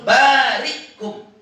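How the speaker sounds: "barikum" high and slow, stopped after "ri"; hamzah sukun. Shown as a demonstration. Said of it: "dengan sukun". ("barikum" pelan, hamzah mati setelah ri)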